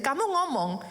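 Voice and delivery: ordinary talk, nothing marked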